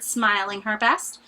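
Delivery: plain reading voice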